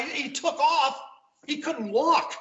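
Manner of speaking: high, incredulous retelling of his own shock; retold disbelief. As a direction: replay the moment you heard he drove off. Retelling shock at valet news.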